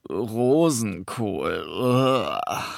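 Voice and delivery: very slow, drawn-out disgust with a shudder; boy naming the food he hates, in disgust. A narrator's reading. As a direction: say the name like it tastes awful. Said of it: "schüttelte angeekelt den Kopf".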